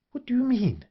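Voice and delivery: high-pitched, sharp question; sharp demand. A narrator's reading. Woman challenging the man over his marriage idea, sharply, high-pitched. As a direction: demand an explanation, pitch leaping up. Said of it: "she demanded sharply".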